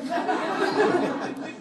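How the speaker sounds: a quick burst of real laughter; bright amused chuckle; short laugh. Real, not acted. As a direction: laugh it off before answering. Amused by the audience question.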